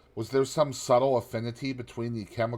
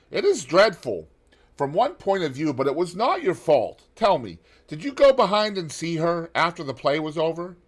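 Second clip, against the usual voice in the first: high, loud gentleman's voice, full of sympathy; Lord Henry; 9 semitones higher, 8 dB louder than usual. A narrator's reading. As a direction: lift the voice high and pour out the condolence. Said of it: "answered Lord Henry".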